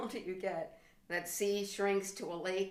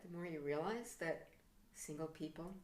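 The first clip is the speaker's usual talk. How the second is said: normal talking voice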